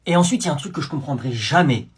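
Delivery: ordinary talk, nothing marked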